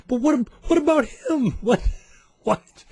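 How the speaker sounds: very high, stammering mock outrage; incredulous protest. Traveler pointing at his waved-through friend, incredulous. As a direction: squeak it out in comic disbelief, breaking into laughter.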